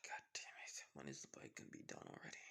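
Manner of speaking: fed-up whispered grumble, fast at the end; whispered groan of impatience; frustrated whisper. Acted. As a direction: hiss it under your breath in frustration. Izuku frustrated after sighing.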